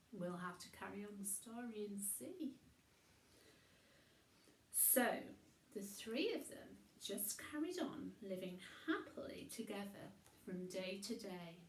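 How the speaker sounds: plain reading voice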